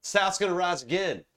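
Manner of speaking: lightly acted Southern rallying cry; Southern boy. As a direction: proclaim it with a Southern accent. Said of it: "Southern boy".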